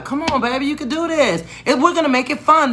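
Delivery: high-pitched coaxing plea. Retold or imitated. Teacher persuading a pupil to spell aloud, coaxing. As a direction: sweet-talk a reluctant child in a high voice. Coaxing a reluctant child, retold.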